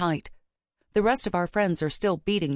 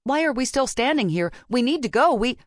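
higher, pressing urgency; urgent; 8 semitones higher, 4 dB louder than usual. A narrator's reading. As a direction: press them urgently to get moving. Urgent push to leave.

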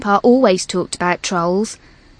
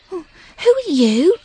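mildly nervous question, raised in pitch; a touch of timid wonder, voiced higher; nervous whisper; 9 semitones higher than usual. A narrator's reading. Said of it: "Poppy whispered nervously".

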